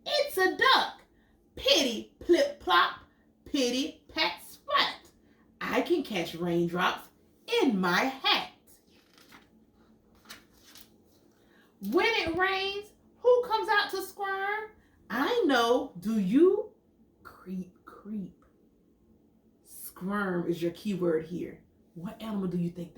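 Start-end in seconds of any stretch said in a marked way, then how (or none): 1.6-16.7 lively storybook read, big high questions; animated picture book reading; reading to children. Acted. Reads picture book aloud. 17.2-18.5 soft creeping whisper; hushed sneaky whisper. Acted. Worm creeps in story.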